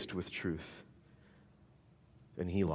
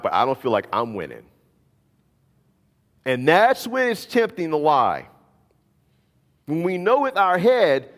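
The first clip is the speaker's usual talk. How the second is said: loud, higher preaching push; 5 semitones higher, 6 dB louder than usual. Acted. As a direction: build the sermon with urgency. Sermon climax delivery.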